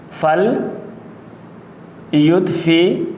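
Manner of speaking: فل یطفیہا split into parts with long pauses, rising mid-word; slow dictation. Shown as a demonstration. Teacher dictates the word in pieces.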